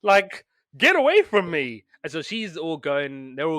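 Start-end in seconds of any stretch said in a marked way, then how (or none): 0.0-1.8 louder, higher mock revulsion; repulsed mother hen. Podcaster voices mother hen rejecting duckling, repulsed. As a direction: shoo it off in acted disgust. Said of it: "And she's like".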